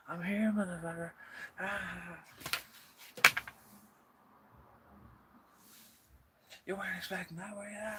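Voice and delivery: high, loud taunting voice for the popped-out item; gleeful gotcha squeak; squeaky mocking ambush voice; cheeky object voice. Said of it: "It was just like".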